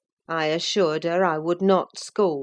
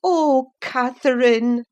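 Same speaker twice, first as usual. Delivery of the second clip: slow, loud sorrowful cry; cried sorrowfully; 7 semitones higher, 8 dB louder than usual. A narrator's reading. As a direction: grieve over her name. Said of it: "I cried sorrowfully".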